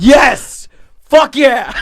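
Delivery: high, loud joyful whoop; real thrilled cheering; excited cheer. Real, not acted. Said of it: "I was so happy to hear [name]".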